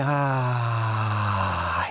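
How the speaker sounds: long exhaled ah sound like a sigh. Shown as a demonstration. Teacher demonstrating the waterfall breath, sighing ah. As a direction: let the breath fall out as an open ah. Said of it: "like a sigh".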